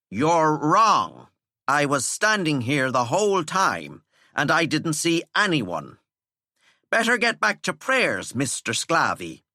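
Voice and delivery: teacher dismissing prefect; dismissive teacher. Acted. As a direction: shoo him away.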